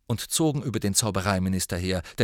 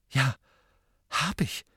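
leicht erschrocken; 4 semitones higher than usual. A narrator's reading. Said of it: "klang erschrocken".

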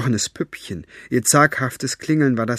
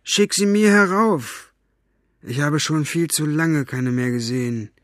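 calling out Staubfinger; 5 semitones higher, 3 dB louder than usual. A narrator's reading. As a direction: call down, pleading lightly. Staubfinger calls up to Buster.

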